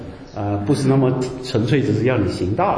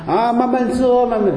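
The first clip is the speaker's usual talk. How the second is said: higher, preachy mock reproach; mock scolding; 9 semitones higher than usual. Preacher mimicking people who scold hot-tempered believers with the verse, mock-preachy. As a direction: imitate someone lecturing a hothead. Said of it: "拿来责备那些脾气暴躁的人啦".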